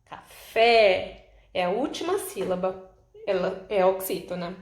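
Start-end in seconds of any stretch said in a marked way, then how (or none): 0.0-1.3 café with FÉ loud and stretched; stress on last syllable. Shown as a demonstration. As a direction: punch the final FÉ of café. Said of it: "a última sílaba é a sílaba mais forte". (café com o FÉ final bem forte e alongado)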